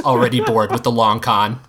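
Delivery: ordinary talk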